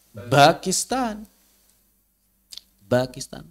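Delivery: Bakistan said slowly with a voiced b instead of p; Pakistan with b. Shown as a demonstration. Teacher showing how Arabs read Pakistan, slow b sound. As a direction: turn the p of Pakistan into a soft b: Bakistan. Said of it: "Makanya Pakistan dibaca".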